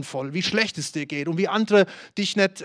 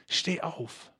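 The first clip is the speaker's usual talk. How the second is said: a much softer, urging call to get up; soft insistence; 9 dB quieter than usual. Acted. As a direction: coax them to their feet gently. Quiet repeat of the command.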